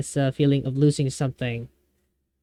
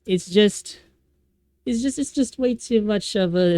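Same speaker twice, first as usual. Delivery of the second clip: choked up; 6 semitones higher than usual. Real, not acted. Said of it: "about to cry because of this".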